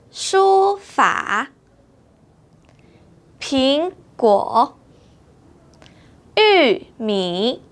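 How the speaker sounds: 书法 苹果 玉米 with the third tone kept full; tone examples. Shown as a demonstration. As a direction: say each word slowly, tones exact. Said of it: "we don't need to change tone".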